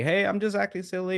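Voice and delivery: high mocking kid voice, a playful chirp; silly kid voice.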